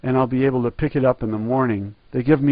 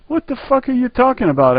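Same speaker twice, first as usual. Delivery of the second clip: same question pitched much higher; repeated louder; 12 semitones higher than usual. Retold or imitated. Customer repeating to the clerk, much higher and exasperated. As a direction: shoot it up high in exasperation. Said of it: "I say again".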